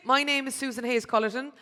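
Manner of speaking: ordinary delivery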